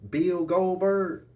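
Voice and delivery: drawn-out hype announcement; announcer reveal. Acted. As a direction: call the name like a ring announcer. Announces the wrestler's full name.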